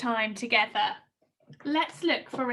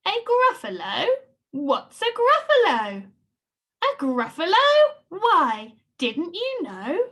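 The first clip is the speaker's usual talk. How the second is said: story characters voiced; 5 semitones higher, 6 dB louder than usual. Dialogue reread.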